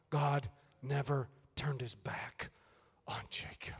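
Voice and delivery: hushed, intimate whisper. Real, not acted. Preacher confiding to congregation, soft whisper. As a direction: whisper it close and tender.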